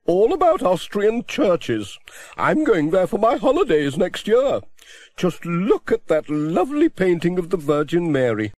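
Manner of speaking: a chatty spinster complaining cheerfully; voiced Miss Hubbard.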